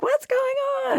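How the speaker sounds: high-pitched bewildered onlookers; mock alarm. People in the room react to the cold breeze, mock alarm. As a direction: imitate puzzled people in a high voice.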